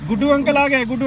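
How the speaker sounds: bright excited call; excited announcement. Real, not acted. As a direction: hype the pets up about uncle. Announcing uncle to distract pets.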